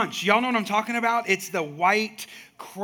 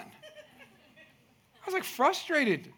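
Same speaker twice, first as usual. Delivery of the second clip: laughing; 9 semitones higher, 3 dB quieter, much slower than usual. Real, not acted. Laughing at laundry frustration.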